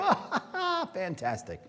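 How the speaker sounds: mock delight. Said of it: "you're not gonna go".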